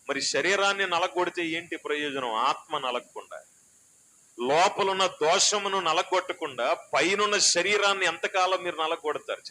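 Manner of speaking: fiery sermon voice; full-force preaching. Acted. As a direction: drive it home from the pulpit.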